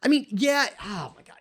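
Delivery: real worked-up disbelief, stretching words for emphasis. Real, not acted. Host fed up with selfish parents' mentality, worked up. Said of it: "I know I'm getting worked up".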